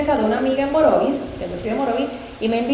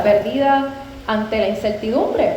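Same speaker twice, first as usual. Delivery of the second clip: usual speaking voice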